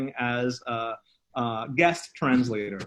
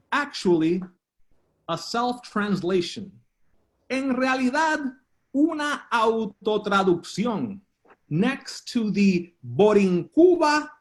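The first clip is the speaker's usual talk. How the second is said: spoken-word poem with deliberate pauses; improvised poetry recital; 4 semitones higher, slower than usual. Acted. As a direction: declaim it phrase by phrase, letting pauses hang. Performs improvised bilingual poem.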